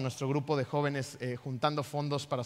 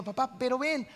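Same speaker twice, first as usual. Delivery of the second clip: put-on higher, childlike coaxing; girl insisting; 7 semitones higher than usual. Daughter begging her dad to come dance, childlike insisting. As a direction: plead again like a little girl.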